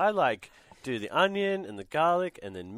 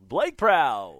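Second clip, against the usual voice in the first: announcer voice; 7 dB louder than usual. Retold or imitated. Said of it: "announcer name".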